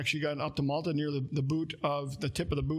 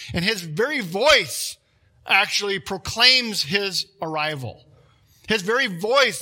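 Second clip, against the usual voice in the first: slow, louder weight on "his very voice"; emphatic preaching; 7 dB louder, slower than usual. Acted. Preacher to congregation about Christ's voice, slow and loud. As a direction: lean hard on "voice", drawn out and loud.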